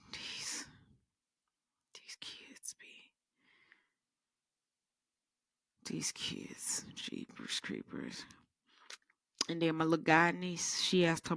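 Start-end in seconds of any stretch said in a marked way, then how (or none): 0.1-8.3 soft unvoiced whisper of disbelief; exasperated whisper. Real, not acted. Exasperated whisper about kids.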